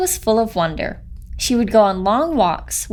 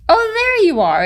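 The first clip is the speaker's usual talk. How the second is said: much higher, delighted greeting; excited exclaim; 11 semitones higher than usual. A narrator's reading. Bird greeting the lost chicken, high and delighted. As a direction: greet a lost child with relief. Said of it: "it exclaimed".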